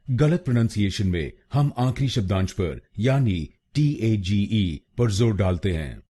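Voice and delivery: normal talking voice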